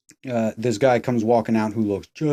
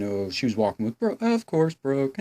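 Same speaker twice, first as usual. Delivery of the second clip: ordinary talk, nothing marked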